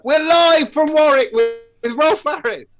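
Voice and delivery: loud, high, drawn-out show introduction; over-the-top live broadcast announcer impression. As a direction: belt it out like a game show host.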